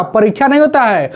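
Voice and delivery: ordinary delivery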